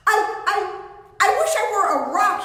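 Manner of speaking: high, loud, stammering panic; panicked cry. Acted. Donkey panics before the hungry lion, high frightened cry. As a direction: blurt the wish in terror.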